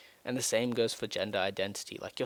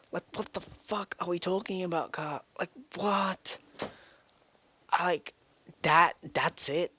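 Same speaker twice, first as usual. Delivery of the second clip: outraged disbelief; 9 semitones higher than usual. Real, not acted. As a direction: throw it out in stunned outrage. Outraged at parents' rejection.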